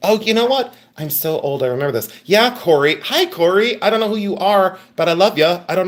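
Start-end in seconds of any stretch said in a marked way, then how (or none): none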